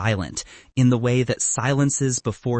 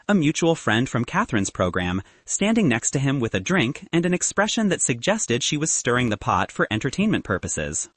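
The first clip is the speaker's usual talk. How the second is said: usual speaking voice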